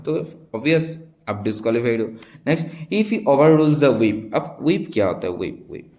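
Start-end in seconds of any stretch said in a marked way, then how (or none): 2.9-4.3 reading a question. Shown as a demonstration. Teacher reads quiz question aloud.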